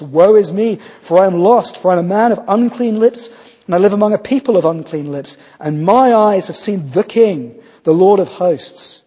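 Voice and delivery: preacher booming Isaiah's woe to the congregation; Isaiah's lament declaimed. Acted. Isaiah's cry quoted in sermon.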